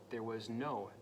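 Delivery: plain reading voice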